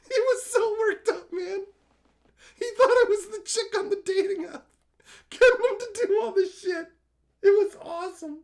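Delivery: high, loud laughing talk; giddy laugh-talk. Real, not acted. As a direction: still cracking up as you tell it. Recalling prank while laughing.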